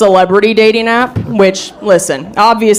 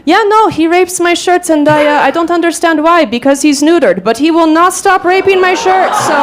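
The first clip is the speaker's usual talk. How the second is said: higher mock European accent, unbothered; 8 semitones higher than usual. Date insisting about his cat, in a higher mock European accent. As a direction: imitate his accent with zero hesitation. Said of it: "he had this really thick European accent".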